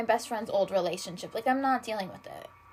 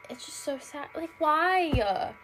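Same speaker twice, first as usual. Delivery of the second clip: ordinary talk, nothing marked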